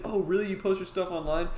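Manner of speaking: high-pitched, interested reaction; retold surprise. Bartender to the artists about their web comics, surprised. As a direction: imitate a bartender pleasantly taken aback.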